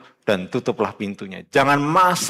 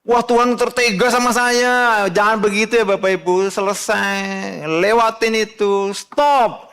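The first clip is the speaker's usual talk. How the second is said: fiery preaching; 9 semitones higher, slower than usual. Real, not acted. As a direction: drive it home from the pulpit. Preaching at full force. (khotbah berapi-api)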